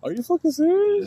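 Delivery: high, sharp shocked question; shocked disbelief. Real, not acted. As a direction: blurt it out in disbelief. Shocked by friend's admission.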